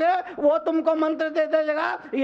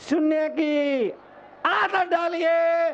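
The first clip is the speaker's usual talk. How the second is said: rising to a high, stretched appeal; building exhortation; 5 semitones higher than usual. Real, not acted. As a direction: climb in pitch and draw out the ending. (सुर चढ़ाते हुए ज़ोरदार नसीहत)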